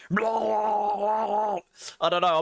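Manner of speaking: high, very slow growly drawl; a hint of a Grizzly Rage voice; Grizzly Rage impression. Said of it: "Work on the Grizzly Rage impersonation".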